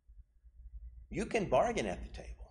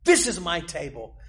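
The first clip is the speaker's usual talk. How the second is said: much higher and louder, preached at full force; Jesus proclaiming; 9 semitones higher, 6 dB louder than usual. Acted. Jesus claiming his table for broken people, full-force preaching. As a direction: thunder out the welcome to the broken. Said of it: "And Jesus said".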